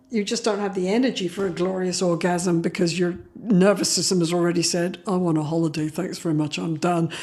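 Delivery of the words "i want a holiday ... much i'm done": low, deadpan resignation; mock weary. Exhausted nervous system quitting on sex, mock weary. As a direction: sign off drily, voice dropped low.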